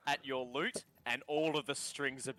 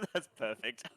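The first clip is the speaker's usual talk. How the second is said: laughing; 3 semitones lower than usual. Real, not acted. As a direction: say it through real laughter. GM laughing at player's joke.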